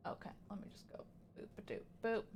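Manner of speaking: soft whisper to herself. Real, not acted. Streamer whispering to herself while clicking, soft whisper. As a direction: breathe it out under her breath.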